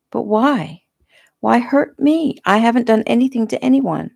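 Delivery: plead, pitch climbing on the questions; distressed pleading. A narrator's reading. Captive pleading with her attacker, distressed.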